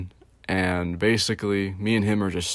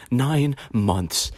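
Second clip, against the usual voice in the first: higher, drawn-out imitation, eerie and trembling; Joker voice; 5 semitones higher than usual. Coworker revealing how long it took, imitated Joker voice. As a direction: stretch it out like an unsettling villain. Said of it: "he's talking like the fucking Joker".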